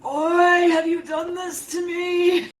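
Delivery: put-on slow, shouted lament in a thick Irish accent. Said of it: "thick Irish accent".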